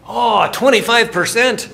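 loud, high mock-Scottish exclamation; terrible Scottish accent. Scotsman answering about discount rate, terrible Scottish accent. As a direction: belt it out in a bad Scottish accent. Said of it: "That's my terrible Scottish accent".

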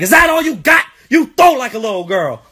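high-pitched heated taunt; trash-talking kid. Retold or imitated. Nine-year-old talking back to dad during catch, taunting. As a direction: imitate a fired-up child goading his dad. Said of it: "So I start talking shit back".

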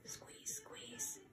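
whispered high squeeze. Shown as a demonstration. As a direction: whisper it playfully. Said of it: "So let's do orange".

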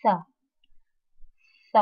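th said with the tongue between the teeth, breathy; English th sound. Shown as a demonstration. Teacher demonstrates the th sound. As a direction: push air past the tongue for th. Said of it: "Mình đọc lại ha".

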